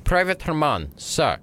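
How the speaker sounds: high name-and-rank reply; soldier reporting. Acted. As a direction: answer high, like a private on parade. The last soldier responded with.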